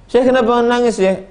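high, bewildered question; puzzled asking. Acted. Student presses the sheikh again, high and bewildered. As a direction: ask again in disbelief.

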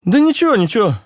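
very high, airy brush-off; dismissive. A narrator's reading. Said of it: "отмахнулся Руслан". (высоко, отмахиваясь)

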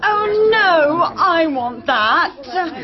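high whine breaking into a cry; whining. Acted. Grabbing at the table before others, near tears. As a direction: wail it like a spoilt child denied. Squabbling heirs grabbing the spoils.

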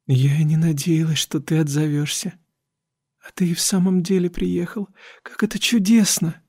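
lightly voiced frail young woman; Dora's voice. A narrator's reading. Dora speaks, voiced by reader.

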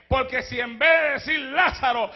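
ordinary talk

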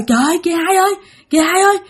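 high, frightened acted voice; high-pitched alarmed plea to a companion; panicked cry. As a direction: call out in rising panic, words tumbling. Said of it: "Thì sợ quá".

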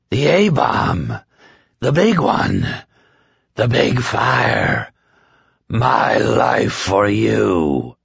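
ecstatic slow offering, like a convert; rapturous Trash Can Man. A narrator's reading.

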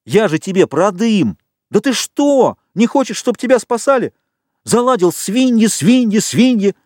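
high, heated tirade; carried away with indignation; indignant outburst. A narrator's reading. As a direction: let loose in exasperation, pitch way up. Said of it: "Ральфа от негодования уже понесло".

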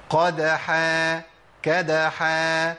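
teacher contrasting the two letters; qaf versus kaf. Shown as a demonstration. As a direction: set قدحا and كدحا side by side, slowly. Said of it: "أبدل الحرف وأخليها الكلمة تتغير".

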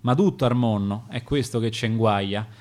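ordinary delivery, nothing marked

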